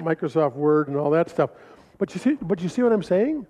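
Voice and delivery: ordinary delivery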